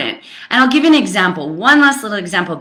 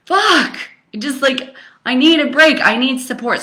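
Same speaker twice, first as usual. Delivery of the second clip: replaying a tearful outburst, mildly; retold breakdown; 6 semitones higher than usual. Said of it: "had a little cry in the bathtub".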